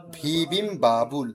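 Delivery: "ভি বিম বাবুল" slow, split into two parts; two-part pronunciation. Shown as a demonstration. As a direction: stretch "ভি বিম", then give "বাবুল" separately. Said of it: "দুই ভাগে উচ্চারণ করবেন".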